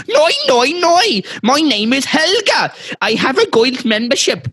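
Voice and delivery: very high, hammed-up tantrum in a wandering foreign accent; screechy fake outrage, accent all over the place; mock screaming. Customer pounding the table, mock screaming about her membership. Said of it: "Customer, screaming and slamming her fists".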